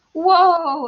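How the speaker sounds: high, loud amazed "Whoa!"; exclaiming. A narrator's reading. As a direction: burst out in amazement. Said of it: "exclaimed Anna".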